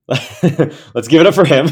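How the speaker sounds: laughing through the words; slow, loud, laughing cheer. Real, not acted. Joking applause call for friend.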